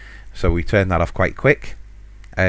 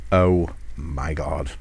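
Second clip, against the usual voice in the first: embarrassed mum; 5 semitones lower, 4 dB quieter, much slower than usual. Retold or imitated. Embarrassed mum reacts to fart.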